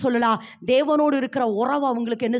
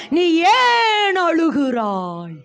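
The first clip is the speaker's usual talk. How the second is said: drawn-out question; 4 semitones higher, 5 dB louder, much slower than usual. Acted. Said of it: "ஏசப்பா சொல்றாரு".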